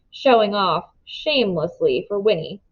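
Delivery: plain reading voice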